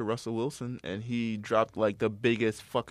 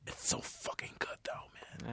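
whispering; 15 dB quieter than usual. Real, not acted. Hushed hype about the show.

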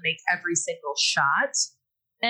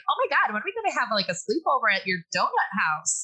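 fast, high gushing friend voice; mock bubbly girl; 3 semitones higher, faster than usual. Teammate teasing about sleepover, gushing fast. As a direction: gush it out like a giddy teen. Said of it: "she's all like".

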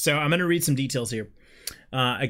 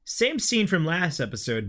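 ordinary talk, nothing marked

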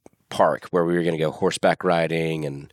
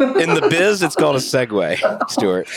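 mock insider; 7 semitones higher, 3 dB louder than usual. Mock industry-insider line.

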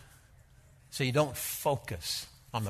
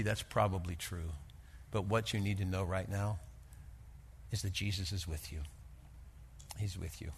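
softer, lower, gentle comfort; tender reassurance; 6 semitones lower, 9 dB quieter than usual. Retold or imitated. Pastor softly reassuring a frightened young woman, tender. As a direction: hush it down to comfort her.

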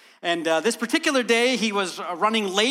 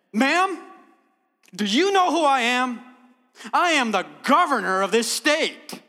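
loud, high-pitched show of authority; stern commanding voice; 5 semitones higher, 4 dB louder than usual. Retold or imitated. Governor pulling rank on the chicken lady, sternly commanding. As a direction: pull rank with full weight of office. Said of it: "the sternest, most, most commanding voice that he could".

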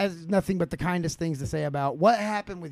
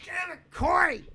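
high, sharp shout at a friend; frustrated shout; 11 semitones higher than usual. Real, not acted. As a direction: yell in real annoyance. Yelling at cohost interruption.

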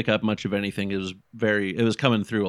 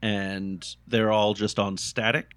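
usual speaking voice

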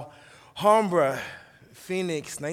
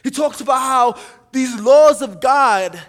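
loud, raised, driving sermon delivery; full-force preaching; 5 semitones higher, 9 dB louder than usual. Acted. Preacher telling the church how the psalmist loves God's law, full-force preaching. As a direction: preach it forcefully, punching the key words.

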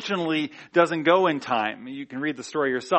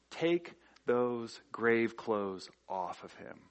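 slow, low and soft quoted command; quiet, solemn command; 5 semitones lower, 9 dB quieter, much slower than usual. Retold or imitated. Jesus to those at Lazarus's tomb, quiet solemn command. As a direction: say it softly, spacing out each word.

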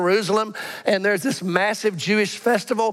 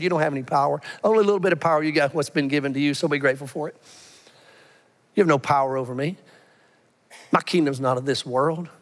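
calm low reply; 5 semitones lower, faster than usual. Acted. Jesus answers Pilate calmly.

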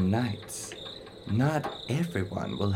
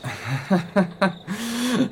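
awkward, too-high polite laugh; forced high laugh; 10 semitones higher than usual. Acted. Lindvig laughing off the Prime Minister's jab, forced and high. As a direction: laugh it off though the joke stung.

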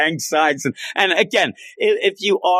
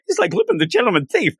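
high fangirl squeal; giddy squeal about the handsome hero; excited squeal; 6 semitones higher, faster than usual. Real, not acted. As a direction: gush about the gentleman thief. Excited gushing over Lupin.

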